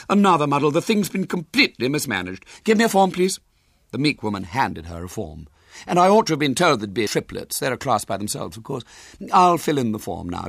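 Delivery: high, loud, prim busybody voice; a bossy lady interrogating the boy; lifted, carrying voice of a nosy woman; officious lady. Acted. Officious woman filling the form.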